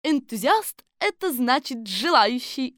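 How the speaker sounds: high, loud explainer; Колбочкина. Acted. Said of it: "объяснила Колбочкина". (высокий голос объясняющей женщины)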